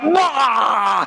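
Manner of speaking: hammed-up high shout, mocking; mock roar. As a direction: bark a mock battle cry. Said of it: "they're just like".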